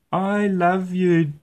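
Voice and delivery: high, slow, drowsy little bear voice; sleepy little cub. Acted. Tired Little Bear trying to tell his daddy, sleepily. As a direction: drift off mid-sentence, very slowly. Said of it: "began Little Bear".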